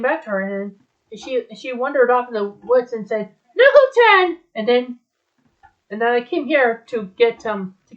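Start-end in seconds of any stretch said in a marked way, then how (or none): 3.6-4.4 loud, very high yell; full-voiced searching call. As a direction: yell the name into the woods. Wandering in woods, calling out.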